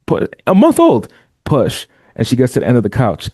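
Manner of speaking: ordinary talk, nothing marked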